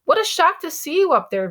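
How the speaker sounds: high-pitched surprised delight; amazed. A narrator's reading. Dad to Via after the play, amazed. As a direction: exclaim it in astonishment.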